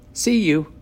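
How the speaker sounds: happy goodbye. A narrator's reading. Boy parting from friend after bus win, happy. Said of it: "he said happily".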